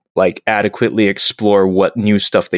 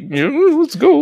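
high eager chirp; imitating Data, pitched up; mock eager Data. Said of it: "Dave's just like".

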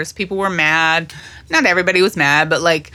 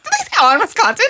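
incredulous; much faster than usual. Retold or imitated. Disbelieving self-quote, rewound show.

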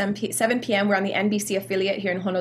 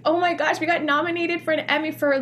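excited; 7 semitones higher than usual. Retold or imitated. Retelling her stunned nomination reaction.

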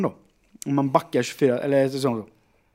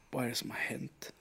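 very soft, dazed question; bewildered murmur; 3 semitones lower, 16 dB quieter than usual. Retold or imitated. Man waking sore all over, quiet bewilderment. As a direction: say it barely aloud to yourself.

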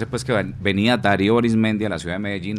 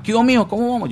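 friend's voice; 14 semitones higher, 4 dB louder than usual. Retold or imitated. Compadre voiced on phone.

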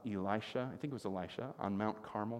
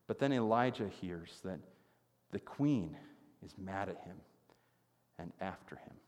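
ordinary talk, nothing marked

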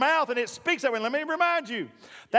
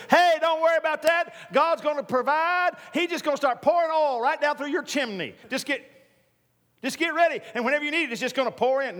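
mock casual prophet; 6 semitones higher than usual. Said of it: "he didn't talk like that".

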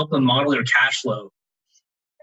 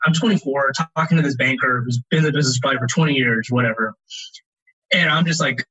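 ordinary delivery

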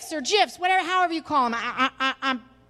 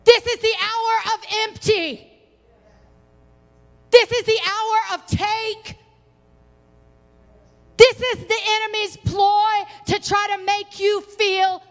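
high, ringing sermon delivery; impassioned preaching; 7 semitones higher than usual. Real, not acted. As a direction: proclaim it in separated, emphatic bursts. Preaches to congregation.

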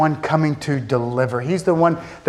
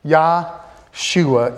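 "Yahshua" drawn out slowly, every syllable spaced; slow, careful Yahshua. Shown as a demonstration. Preacher teaching the name to his congregation, spoken slowly. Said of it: "if we say it faster".

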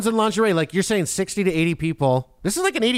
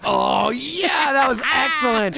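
excited; 6 semitones higher, much slower than usual. Real, not acted. Host cheers the sexy take.

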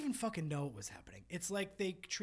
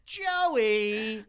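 ditzy mocking voice; 4 semitones higher, 12 dB louder than usual. Continuing mock voice.

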